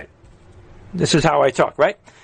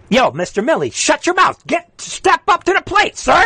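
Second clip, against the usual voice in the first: pitched-up mocking yell at Millie, much higher; taunting high yell; 13 semitones higher than usual. Real, not acted. Host taunting the general to come out, high mocking yell. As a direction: call him out, voice jumping way up.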